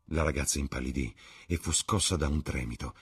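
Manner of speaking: usual speaking voice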